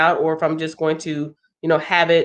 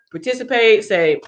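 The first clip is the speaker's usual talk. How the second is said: ordinary talk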